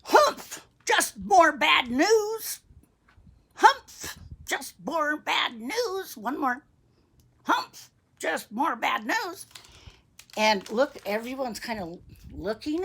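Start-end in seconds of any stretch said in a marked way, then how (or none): 0.0-6.2 loud high humph, then a slow grumble; grouchy old man. Acted. Old man at the newsstand, grouchy. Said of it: "the grouchy old man says". 7.4-9.5 slow, higher-pitched grumble; huff, then drag out the complaint; grouchy old man. Acted. Old man with his newspaper, grouchy. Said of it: "the grouchy old man says".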